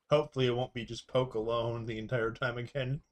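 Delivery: ordinary talk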